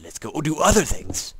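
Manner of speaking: high, loud burst of mock boredom; acted impatient outburst. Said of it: "me, I'm like".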